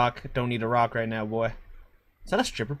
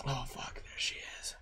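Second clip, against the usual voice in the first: whispering; 3 semitones lower, 17 dB quieter than usual. Real, not acted. Hiding, whispering near monster.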